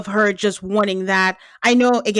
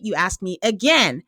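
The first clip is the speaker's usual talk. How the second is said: normal talking voice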